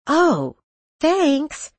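high, snide fake thanks; sarcastic. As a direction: toss it off with mock sweetness. Trish snubs Penny.

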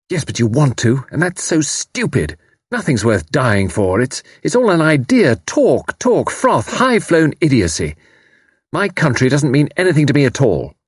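high-pitched, impassioned contempt; scornful outburst. Acted. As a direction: argue hotly, spitting out each scornful word. Angry argument against dying for country.

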